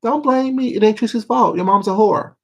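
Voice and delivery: high, loud mock rant in Tracy's voice; imitating Tracy. Imitating Tracy ranting at the chat, high and loud. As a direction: squeal it out like Tracy going off. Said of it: "She be like".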